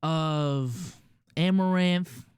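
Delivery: ordinary talk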